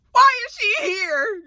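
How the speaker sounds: very high tearful wail; comic distressed squeal; mock whine. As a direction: whine it high like a hammed-up crybaby. Acts out Fame's whining dismay.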